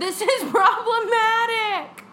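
high, loud, drawn-out mock complaint; mock-outraged wail. Hammering the film's premise home to the co-host, mock-outraged wail. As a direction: wail it slowly in exaggerated dismay.

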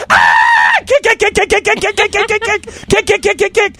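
high frantic screaming of kick, kick. Retold or imitated. Man imitating his girlfriend's night terror, high screaming. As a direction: shriek kick over and over in panic. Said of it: "she jumps up screaming".

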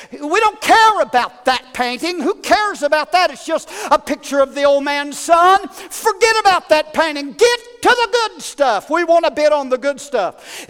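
crying out. Acted. Bidder heckling the auctioneer, loud and impatient. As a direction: yell it from the crowd, fed up. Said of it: "someone cried out and said".

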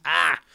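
loud sudden ah at a tickle in the nose; startled loud ah; sharp sneeze-like yelp. Real, not acted. Stray hair, about to sneeze.

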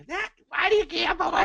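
high, whiny mocking voice; mocking nag. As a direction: sneer at the scolding. Mocking a nagging non-gambler.

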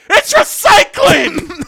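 high, loud, drawn-out mock yell; retold fed-up shouting; exasperated shout. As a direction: bellow it like the umpteenth reminder to a roommate. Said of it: "I don't think shouting's gonna work".

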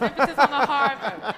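echoing the Harvard joke, laughing loud. Real, not acted.

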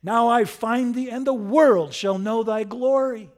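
high, booming, rapturous proclamation; full-voiced chivalric ardor; Quixote. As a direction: exalt the beloved before the whole world. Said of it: "sings Quixote".

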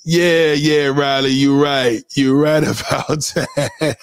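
drawn-out words breaking into a laugh; laughing agreement. Real, not acted. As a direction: agree through a slow laugh. Amused agreement with a caller.

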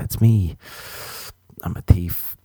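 usual speaking voice

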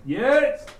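shouted answer from inside the house; loud call. Acted. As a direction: yell it through the closed door. Drunk man calls from inside.

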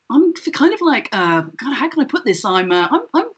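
mock a self-indulgent actor improvising a simple line; overwrought naturalistic actor. Mocking actors who rewrite lines at castings, hammed-up. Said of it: "They would change it to".

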